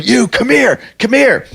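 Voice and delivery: high-pitched mock summons; imitating menacing owner. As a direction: call him over like the threatening owner, pitch up. Owner calling him over.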